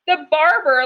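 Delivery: ordinary delivery, nothing marked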